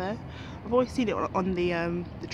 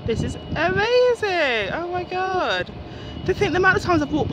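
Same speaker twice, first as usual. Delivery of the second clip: awed; 10 semitones higher, 4 dB louder than usual. Real, not acted. Awed by landing plane view.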